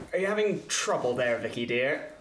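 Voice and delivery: mocking call. [name] calls out to Vicky, mocking and defensive. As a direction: needle her with false sweetness. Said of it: "call out just almost, almost defensively".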